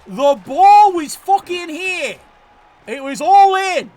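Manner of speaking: loud slow furious yell; furious shout. Real, not acted. Gamer raging at the team's wasted chance. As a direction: hammer each word, furious. Scolding the AI coach.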